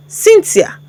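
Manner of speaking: usual speaking voice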